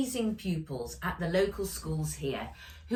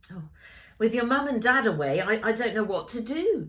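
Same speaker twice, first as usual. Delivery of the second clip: flustered; 5 semitones higher, 5 dB louder, faster than usual. Acted. Grandpa flustered.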